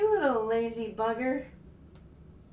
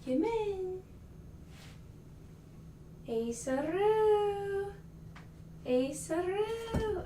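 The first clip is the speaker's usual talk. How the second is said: higher, slow, stretched-out call of Ace-a-roo; sing-song cat call; 7 semitones higher, 4 dB quieter, much slower than usual. Real, not acted. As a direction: draw out Ace-a-roo like calling a pet. Calling the other cat.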